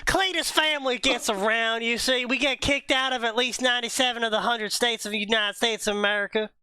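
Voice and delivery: high put-on Southern yokel voice; Cletus voice. Player in character explaining the Cletus family to the table. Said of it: "All right, Cletus".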